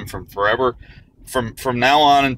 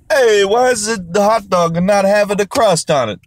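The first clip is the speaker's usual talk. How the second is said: higher, louder put-on European voice; mock European; 6 semitones higher, 3 dB louder than usual. Europeans quizzing an American about hot dogs, in a mock European accent. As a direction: lampoon a puzzled European tourist. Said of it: "Europeans are always telling me".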